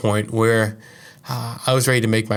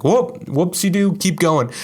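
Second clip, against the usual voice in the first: carefree mock; 7 semitones higher, slower than usual. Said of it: "you can be like".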